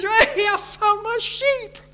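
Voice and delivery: high, slow, delighted call; rejoicing shepherd. Retold or imitated. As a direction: show off the found sheep joyfully.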